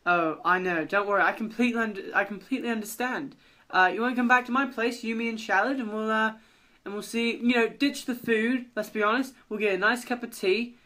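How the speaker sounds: friendly British host offering an invitation; chatty British character voice; British accent. Acted. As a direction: play an understanding Brit, improvising as you go. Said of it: "Mallard and Shallard will have British accents".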